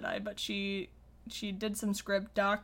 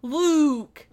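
mock eager pitch; 4 semitones higher, 6 dB louder than usual. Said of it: "Mark's suggestions were like".

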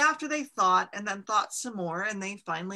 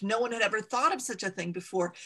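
usual speaking voice

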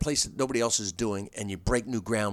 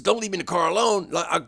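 higher, louder mock panic; mock nervous plea; 6 semitones higher, 5 dB louder than usual. Friend begging not to be left alone in the car, higher mock panic.

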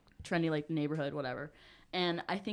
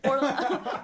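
ordinary delivery

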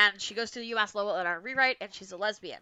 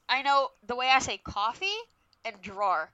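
coffee, drawer and drawing in a Massachusetts accent; 3 semitones lower, 4 dB louder than usual. Shown as a demonstration. Showing co-host her own Massachusetts accent on coffee and drawing. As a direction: let the Massachusetts vowels show in coffee and drawing. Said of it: "that's my Massachusetts accent".

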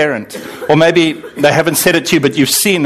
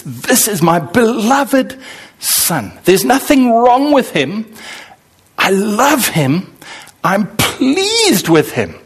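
tender God; 6 semitones higher, slower than usual. Retold or imitated. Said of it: "affection of God to Jesus".

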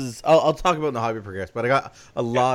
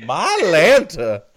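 southern belle exclamation; 4 semitones higher, 8 dB louder, much slower than usual. Pretend. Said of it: "show up as a southern belle".

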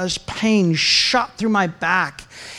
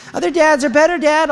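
put-on high child voice, pitched up; imitated daughter; 8 semitones higher than usual. Daughter goading dad on the wall, imitated child voice. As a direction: mimic a kid needling her father.

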